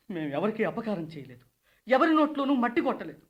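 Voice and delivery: normal talking voice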